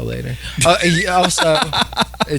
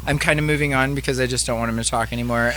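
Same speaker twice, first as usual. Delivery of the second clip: fast, softer aside; quick aside; 6 dB quieter, faster than usual. Real, not acted. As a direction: rush the aside.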